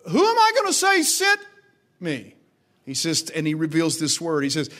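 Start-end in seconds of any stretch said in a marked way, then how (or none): 0.1-2.3 high, loud preaching of Moses' question; full-force preaching. Acted. Preacher voicing Moses asking God, high and loud. Moses asks God's name.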